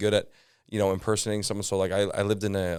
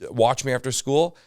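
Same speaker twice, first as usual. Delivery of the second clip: usual speaking voice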